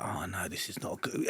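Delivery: near-whispered, low inner thought; hushed aside. Retold or imitated. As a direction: drop right down to a private whisper. Retold inward dread in a hostile bar.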